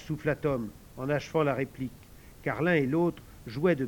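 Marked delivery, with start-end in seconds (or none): none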